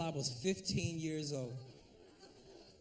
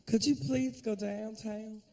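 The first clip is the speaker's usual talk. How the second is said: slow, higher-pitched coy imitation; cute woman's request; 4 semitones higher, slower than usual. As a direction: ask sweetly, dragging out the words. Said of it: "all kind of cute little sayings".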